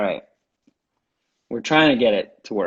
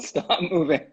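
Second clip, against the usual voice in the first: laughing while repeating the order; amused, half-laughing command; 6 semitones higher, slower than usual. Real, not acted.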